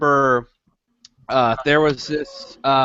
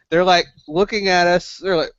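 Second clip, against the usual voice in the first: normal talking voice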